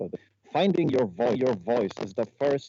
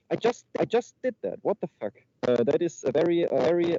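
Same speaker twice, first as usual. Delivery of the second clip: high cartoon mouse voice; Mickey Mouse; 6 semitones higher than usual. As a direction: keep the squeaky mouse impression going. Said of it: "now demonstrate the voice of Mickey Mouse".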